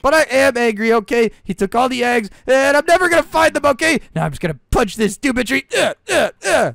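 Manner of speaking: boil over and yell it out; angry. Acted. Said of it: "You don't need to be so angry".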